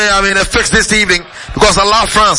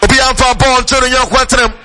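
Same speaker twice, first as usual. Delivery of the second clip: live play-by-play; 2 semitones higher than usual. Real, not acted. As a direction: announce the matchup like a radio caller. Live match commentary.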